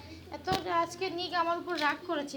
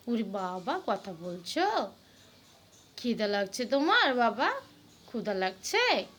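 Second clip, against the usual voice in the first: cooing; 7 semitones lower, slower than usual. Real, not acted. Mother coaxing her sulking son to talk, cooing. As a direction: drop down low and gently coo.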